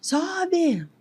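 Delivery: high, retold shout of God's command; a high-pitched retold yell of "Sobe!". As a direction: call it out sharply, high and urgent. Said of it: "Deus gritou".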